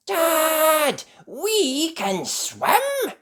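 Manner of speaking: very high, slow, excited call; cried out. A narrator's reading. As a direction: cry out the news to Dad. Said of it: "cried Topsy".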